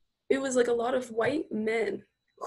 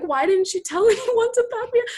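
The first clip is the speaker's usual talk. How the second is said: laughing disbelief; 10 semitones higher, 8 dB louder than usual. Retold or imitated. Laughing disbelief retelling to boss.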